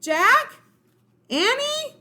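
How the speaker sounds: high, loud questioning calls; calling out. Acted. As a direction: call back and forth searching. Siblings calling for each other.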